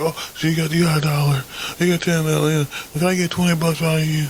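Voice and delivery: high-pitched imitation of a wheedling woman; mock a woman hitting you up for cash; money-begging chick. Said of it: "chicks asking me for money".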